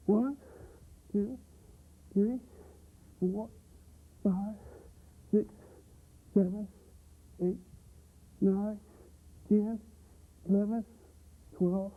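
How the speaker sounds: counting while doing push ups; strained count mid push-up. Real, not acted. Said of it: "do it the Marine style".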